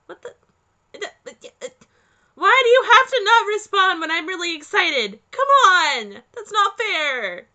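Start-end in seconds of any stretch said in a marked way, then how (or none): 2.4-7.5 high, loud indignant complaint; whiny frustration. Real, not acted. Player upset nobody reacts, high loud whine. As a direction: protest like it's unfair.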